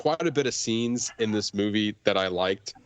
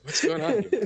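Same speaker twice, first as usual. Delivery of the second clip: high confused voice; 11 semitones higher than usual. Retold or imitated. Quoting baffled characters.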